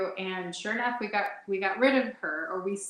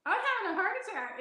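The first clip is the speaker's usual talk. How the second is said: panicked; 11 semitones higher, much faster than usual. Retold or imitated. Panicked reaction to calm roommate.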